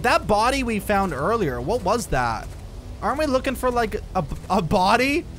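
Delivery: ordinary delivery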